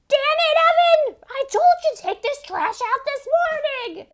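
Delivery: put-on high screaming drunk mom; furious mom yell. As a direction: scream at the son in rage. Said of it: "she's like".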